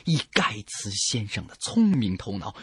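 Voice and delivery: usual speaking voice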